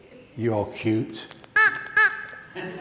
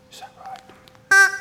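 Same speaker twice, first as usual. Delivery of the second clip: squeaky parrot puppet; 12 dB louder, slower than usual. Pretend.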